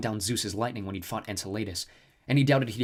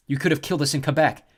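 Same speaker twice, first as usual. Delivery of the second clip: louder, higher accusation; accusing; 4 semitones higher, 7 dB louder than usual. A narrator's reading. Jason accusing the snow goddess, raised voice. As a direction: point the finger at her.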